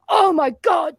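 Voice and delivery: imitating a man under attack; pained outcry. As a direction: cry out as if being beaten. Doctor crying out while being punched.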